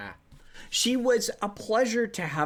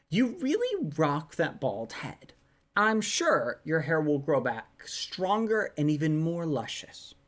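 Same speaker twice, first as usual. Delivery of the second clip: normal talking voice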